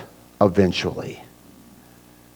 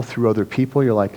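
ordinary delivery

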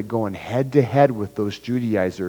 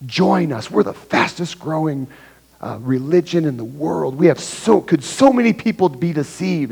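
higher, louder, eager sales pitch; zealous recruiter; 5 semitones higher, 3 dB louder than usual. Retold or imitated. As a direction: mimic a pushy, eager recruiter at the door. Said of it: "See the zealousy there?".

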